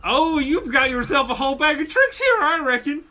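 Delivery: high, loud Southern villain; southern drawl. Acted. Bandit sees the shield spell activate, loud southern drawl. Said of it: "The southern drawl".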